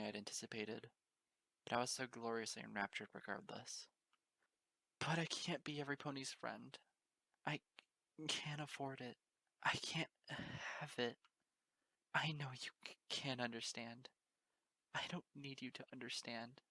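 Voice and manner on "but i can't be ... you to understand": tearful. Acted. Pony sobbing confession.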